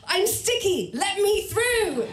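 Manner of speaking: high, slow, hammed-up yell; high drawn-out comic shout; mock scream at crowds. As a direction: bellow it to clear a path, laughing. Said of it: "scream at the crowds".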